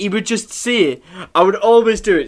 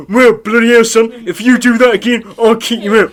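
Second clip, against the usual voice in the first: imitated Southampton teacher, loud and higher; mock Southampton accent; 5 semitones higher, 6 dB louder than usual. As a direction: scold a pupil in a hammed-up Southampton accent. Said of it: "like, a Southampton accent".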